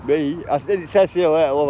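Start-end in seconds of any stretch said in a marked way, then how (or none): none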